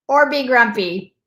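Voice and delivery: usual speaking voice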